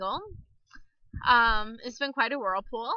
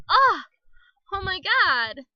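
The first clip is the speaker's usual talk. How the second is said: loud, higher burst of retold relief; gushing relief; 4 semitones higher, 8 dB louder, slower than usual. Woman reliving being understood at last, gushing relief. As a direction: let it burst out, loud then easing. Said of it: "what a relief".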